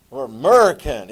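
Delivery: loud, exaggerated American; American accent. Shown as a demonstration. Teacher answering his own question, exaggerated American accent. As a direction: punch it out in broad American. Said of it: "they speak American".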